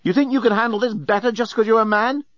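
high-pitched indignant challenge. A narrator's reading. Nettie challenging the journalist who ordered her out, indignant. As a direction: throw it back at him, pitched high.

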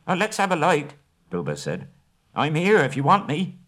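high, light hobbit voice calling out; hobbit's cheeky call. Acted. Bilbo stepping out to surprise the searching elves, cheeky high call. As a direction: pop up and tease the elves.

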